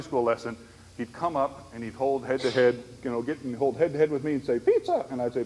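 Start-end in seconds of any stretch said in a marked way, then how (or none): 4.6-5.1 much higher, louder imitated voice; imitating friend. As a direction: pipe it up high like him.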